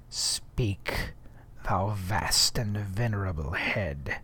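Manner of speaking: low, soft, breathy muttering to the head; awed low mutter to a severed whale head. Acted. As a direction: mutter it low and hushed, almost whispered. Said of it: "muttered Ahab".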